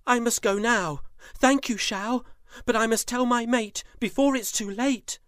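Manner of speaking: high, urgent and hurried; eager tiger. Acted. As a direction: rush it out, she must leave now. Tiger rushes to leave.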